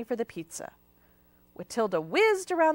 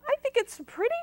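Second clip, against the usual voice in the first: higher, a touch defensive; 13 semitones higher, faster than usual. A narrator's reading. Watilda defending her hairdo to Aunt Bort, mildly defensive. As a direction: justify yourself, lightly hurt. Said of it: "Watilda said defensively".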